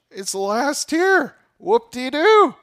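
high, loud, slow sarcastic whoop; sarcastic mock. Mocking emphasis on tongues.

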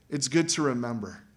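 ordinary delivery

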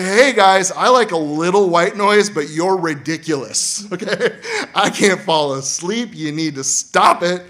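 loud, high complaint at the frogs; fed-up camper. Acted. Sleepless camper yelling at the frogs, fed up. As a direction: scold the frogs, slowing on can't fall asleep.

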